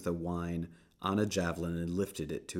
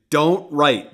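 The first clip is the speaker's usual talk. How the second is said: loud, much higher protest; loud objection; 8 semitones higher, 14 dB louder than usual. Acted. Priests objecting to Pilate's sign, loud protest.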